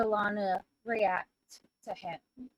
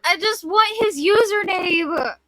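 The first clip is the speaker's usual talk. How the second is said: whiny pleading; 4 semitones higher, 7 dB louder than usual. Real, not acted. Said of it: "I know I sound like a whiny bitch".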